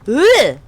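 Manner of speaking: sharp, high pained grunt; a loud annoyed groan at being hit; loud frustrated groan. Real, not acted. Streamer hit by the boss, loud pained groan.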